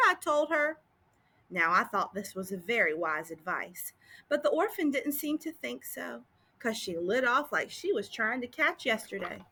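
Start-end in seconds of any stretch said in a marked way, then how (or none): none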